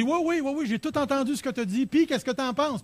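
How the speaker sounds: high and loud, calling across the house; calling from afar. Retold or imitated. A dinner host calling back from the living room, loud and high. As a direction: call out to a guest in the next room. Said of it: "Ça va parler un petit peu plus fort".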